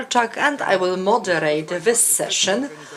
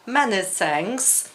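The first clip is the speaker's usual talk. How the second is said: normal talking voice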